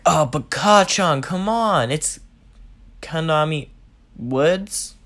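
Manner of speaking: a hint of a fanboy pleading; eager Deku voice.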